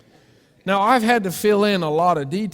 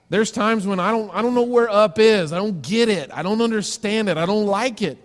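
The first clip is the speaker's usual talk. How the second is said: frustrated outburst; 6 semitones higher, 6 dB louder than usual. Real, not acted. Preacher voices his own despair.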